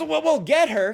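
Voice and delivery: high, loud hammed-up lifeguard; mock lifeguard. Lifeguard to worried parent, hammed-up high voice. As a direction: play the eager lifeguard stepping in. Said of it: "the lifeguard goes".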